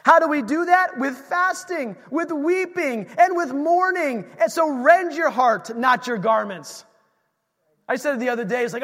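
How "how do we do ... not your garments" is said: shouted, pitch raised, driving hard; full-force preaching. Acted. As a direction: preach it out to the whole church.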